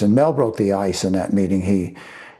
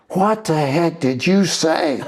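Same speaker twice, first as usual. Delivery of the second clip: higher, drawn-out retold demand; stretched-out mock outrage, pitched up; mock angry agent; 7 semitones higher, slower than usual. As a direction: imitate an agent's indignant demand, slowly. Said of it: "I think he's angry".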